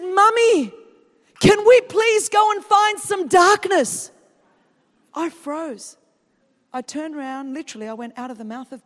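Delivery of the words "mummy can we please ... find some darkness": put-on high, loud, slow call of a little girl; child's yell. Little daughter asking mum in Walmart, loud slow yell. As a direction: call out across the counter like a toddler. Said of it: "So she yells this at me".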